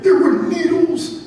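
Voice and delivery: slow, high-pitched horror; old Mouse Johnson. Acted. Old mouse answering the curious mice, slow high dread. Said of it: "Like old Mouse Johnson".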